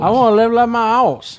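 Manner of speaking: put-on loud, high country accent; heavy country accent. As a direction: belt it in a hammy country accent. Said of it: "That was my heavy country accent".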